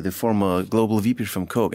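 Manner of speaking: usual speaking voice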